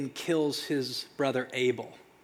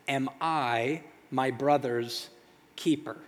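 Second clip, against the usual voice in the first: slow, disbelieving echo; 3 semitones lower than usual. Acted. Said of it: "letting it sit there like".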